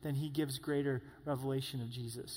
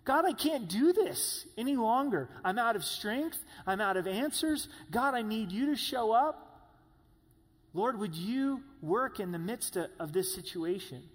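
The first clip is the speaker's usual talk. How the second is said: much higher and louder, pleading to God; desperate cry; 5 semitones higher, 4 dB louder than usual. Retold or imitated. A desperate listener crying out to God for help. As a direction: cry out like you've run out of strength. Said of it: "you're going".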